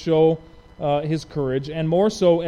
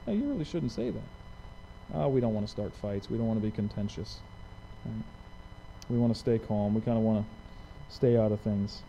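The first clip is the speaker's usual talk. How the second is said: soft, meek and apologetic; a hammed-up meek whisper of excuses; mock timid voice; 5 semitones lower, 9 dB quieter than usual. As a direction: play the scared churchgoer, hushed. Mocking timid believers avoiding conflict.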